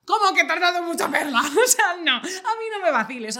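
loud, high-pitched outraged protest; indignant disbelief. Retold or imitated. Daughter challenging mum's claim, in loud incredulous protest. Acted reaction of disbelief to mother.

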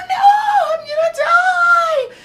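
shrill terrified cry, very high and loud; panicked scream. Acted. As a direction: scream it like you're about to die. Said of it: "so the crazy lady is like".

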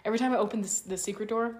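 normal talking voice

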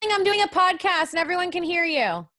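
real annoyed call to another room; calling out to mom. Real, not acted. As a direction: plead upward for the yelling to stop. Calls to mom mid-podcast.

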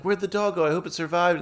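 high-pitched, put-on worry; imitated worried woman. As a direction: imitate someone fretting over a dog. Mimics woman asking after the dog.

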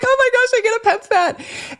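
high, teary squeal of joy; excited squeal. Retold or imitated. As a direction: squeal it like you can't believe your luck.

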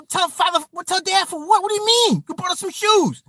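high-pitched, bewildered objection; protesting kid. Retold or imitated. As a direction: argue back like an indignant child. Child protesting mother's threat to tell.

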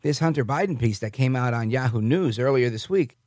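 ordinary delivery

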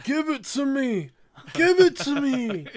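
high, loud grabby demand; demanding whine. Retold or imitated. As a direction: beg like a greedy child. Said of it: "And he's like".

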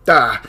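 sudden loud startled shout; startled yelp. Acted. Scholar hearing an unseen voice, startled yelp. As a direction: jump at the hidden voice with a yelp.

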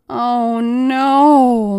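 loud, high pained moan. A narrator's reading. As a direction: moan as if in pain. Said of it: "moaned Mike".

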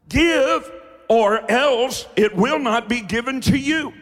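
high, loud, slow, hammering cadence; fiery preaching. Real, not acted. Preacher daring the congregation to shout, at full force.

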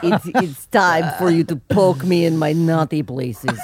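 slow, loud mock-Russian seduction; hammed-up Russian vamp, laughter breaking through; Russian accent. Co-host teasing as the wife, comic Russian accent. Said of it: "in a Russian accent".

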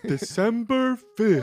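high, slow hammed-up character voice; Cowardly Lion-like voice. Joking about reciting the daily date, hammy character voice. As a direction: echo the date in a silly put-on voice. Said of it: "Have you ever played the Cowardly Lion".

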